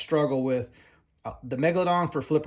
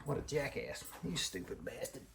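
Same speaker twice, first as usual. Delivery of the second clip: put-on muttered Boston-accent self-scolding, much softer; 14 dB quieter than usual. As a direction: slip into a quiet Boston accent, cursing yourself. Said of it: "it's usually in a Boston accent".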